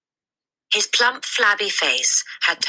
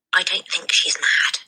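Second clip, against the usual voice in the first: hushed; 5 semitones higher than usual. A narrator's reading. Matilda warns her friend in a hushed voice. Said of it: "Matilda said".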